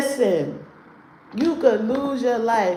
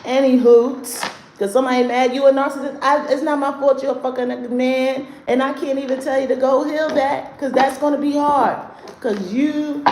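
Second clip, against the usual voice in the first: loud, higher, fast sassy burn; heated roast; 6 semitones higher, 5 dB louder than usual. Real, not acted. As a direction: roast him with raised voice. Roasting a man in chat.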